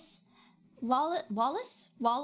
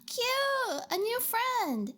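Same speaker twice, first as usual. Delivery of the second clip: high, delighted squeal; excited squeal; 10 semitones higher, much slower than usual. Real, not acted. Streamer gushing over a new pet, excited. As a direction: gush over the cute pet. Gasps at cute animal.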